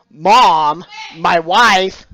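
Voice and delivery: ordinary talk, nothing marked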